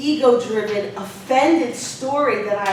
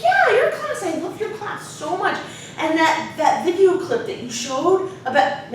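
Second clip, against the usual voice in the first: high-voiced imitation of an exuberant kid; youthful childlike student; 9 semitones higher, 4 dB louder than usual. Student praising his teacher's class, childlike and exuberant. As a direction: gush with childlike sincerity. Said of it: "childlike way of speaking".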